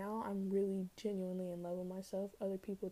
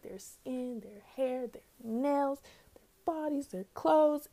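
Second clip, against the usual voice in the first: excited; 5 semitones higher, 8 dB louder, slower than usual. Real, not acted. Gushing over Twitter women's looks.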